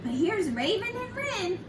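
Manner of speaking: ordinary delivery, nothing marked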